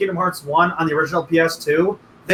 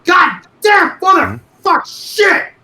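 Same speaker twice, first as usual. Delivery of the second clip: high screeching rage yell; mom raging at game; 12 semitones higher, 6 dB louder, much slower than usual. Retold or imitated. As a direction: scream the curse like a raging gamer. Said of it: "all I'm hearing is".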